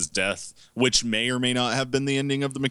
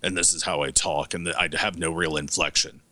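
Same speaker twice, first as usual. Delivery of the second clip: British bad guy voice; 7 semitones lower than usual. Mocking the movie's kidnapper, British bad guy voice. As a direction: drop it deep and drag it out like a Brit baddie. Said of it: "a bad guy voice".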